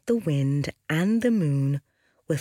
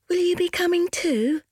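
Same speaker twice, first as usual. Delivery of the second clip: put-on high hopeful young sister voice; 3 dB louder than usual. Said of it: "asked the moon".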